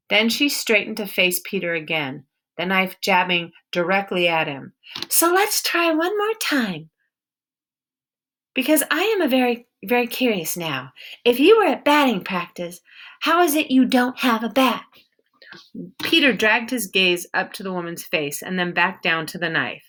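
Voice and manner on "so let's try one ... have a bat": a suspicious woman with a hint of an accent, guarding her barn; stern wary woman. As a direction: hold your ground and interrogate him. Woman threatens with knife.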